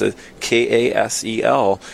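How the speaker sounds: ordinary talk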